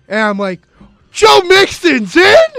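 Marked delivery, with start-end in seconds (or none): 1.1-2.5 high, slow, shouted disbelief; excited shout. Retold or imitated. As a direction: yell it like it's huge news. Dad's excited reaction retold.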